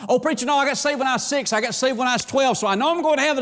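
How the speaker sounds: high-pitched, hurried mock objection; protesting churchgoer. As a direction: rattle it off as a self-assured church member. Voices a complacent churchgoer objecting.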